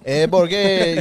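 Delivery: mock Cuban-accented Spanish; exaggerated Cuban accent imitation; put-on Cuban accent. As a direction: mimic her thick Cuban accent. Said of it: "doing a lot of the accent".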